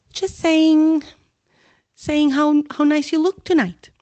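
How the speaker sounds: high, loud nervous stammering; stumbling cover story; flustered stammer. Acted. Hermione flattering Myrtle, flustered. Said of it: "glaring at Peeves".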